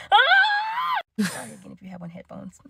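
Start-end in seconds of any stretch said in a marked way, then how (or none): 0.1-1.3 very high-pitched startled shriek; high retold scream. Woken by a flashlight in the face, acted scream. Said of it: "I just start screaming like".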